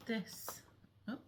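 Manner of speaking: ordinary talk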